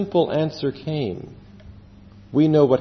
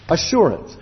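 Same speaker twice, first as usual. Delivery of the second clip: ordinary talk, nothing marked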